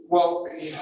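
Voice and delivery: ordinary talk, nothing marked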